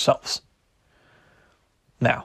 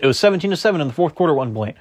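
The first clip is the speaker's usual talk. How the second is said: ordinary talk, nothing marked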